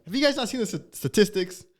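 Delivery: high-pitched mock disbelief; incredulous. Acting out comedian's incredulous bit.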